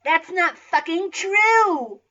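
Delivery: high, very loud outraged yell; hammed-up teenage protest shout. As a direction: yell the objection, drawn out and high. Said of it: "I yelled progressively".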